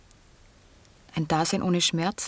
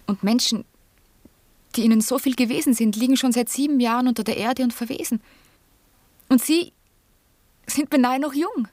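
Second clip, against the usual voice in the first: faster, louder, climbing higher; rising agitation; 5 semitones higher, 5 dB louder than usual. Acted. Johanna bursts out at Herr von Sala, rising agitation. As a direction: let the upset build and break out. Agitated outburst about the dead.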